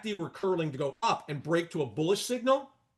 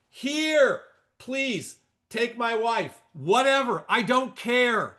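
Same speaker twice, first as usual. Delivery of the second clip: mock exasperation; 5 semitones higher, 5 dB louder, much slower than usual. Joking exasperated offer.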